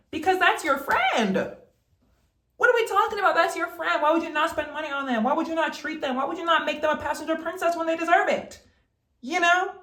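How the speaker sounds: high, emphatic rant; passionate yelling. Real, not acted. Lecturing her viewers about treating friends, passionate yelling. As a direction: yell it like you mean it. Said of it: "And I'm yelling this".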